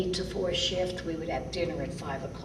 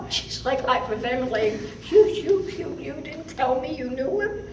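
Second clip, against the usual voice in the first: much higher and louder, breathless stammer; excited captain; 9 semitones higher, 8 dB louder than usual. Retold or imitated. Captain astonished that the young nurse knew [name], stammering excitedly. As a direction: sputter it out like the stunned captain. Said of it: "my captain goes".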